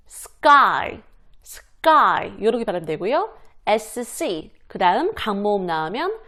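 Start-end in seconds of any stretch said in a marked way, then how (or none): 0.1-3.3 "sky" with a hard, unaspirated k; tense K in sky. Shown as a demonstration. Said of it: "강세가 들어가면 된소리가 난다".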